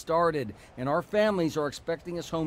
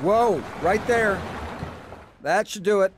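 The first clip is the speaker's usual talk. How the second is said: calling out; 7 semitones higher, 8 dB louder than usual. Acted. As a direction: wave the driver in from behind the trailer. Guiding trailer hitch, calling out.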